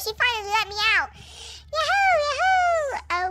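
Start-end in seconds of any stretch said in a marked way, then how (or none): 1.7-3.3 high, slow stretched cheers; drawn-out yahoo. Acted. Horse let out to run, whooping long and high. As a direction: savor each yahoo. Pony running free.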